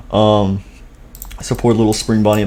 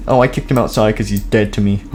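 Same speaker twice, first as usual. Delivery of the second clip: ordinary delivery, nothing marked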